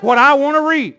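loud, rallying call to the congregation; fervent church shout; preacher's declaration. Real, not acted. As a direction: call it out for the crowd to repeat.

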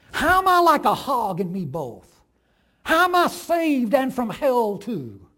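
loud, pressed angry questions; contained fury. A narrator's reading. The farm wife challenges God at the hog pen, furious. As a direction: demand it of God, seething. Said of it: "contained fury".

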